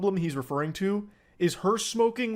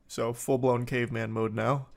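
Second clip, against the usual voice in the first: normal talking voice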